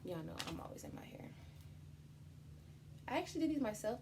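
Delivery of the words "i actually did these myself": high, bright burst of pride; proud and lifted; excited. Real, not acted. Proud hair aside.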